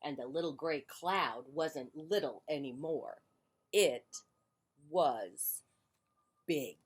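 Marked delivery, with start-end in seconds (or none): 3.7-6.8 It, was, big, each word set apart by pauses; slow dramatic reveal. A narrator's reading. Reader building suspense, It was big with pauses.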